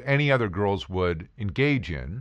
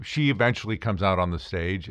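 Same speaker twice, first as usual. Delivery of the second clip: usual speaking voice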